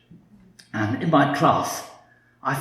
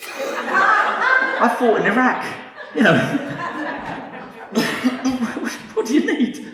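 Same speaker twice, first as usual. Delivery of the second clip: laughing disbelief; 12 semitones higher, slower than usual. Real, not acted. Indignant at job rejection.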